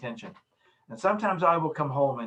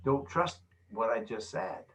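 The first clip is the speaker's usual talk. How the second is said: inner voice aside; 3 semitones lower, 5 dB quieter, slower than usual. Shown as a demonstration. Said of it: "my body language says".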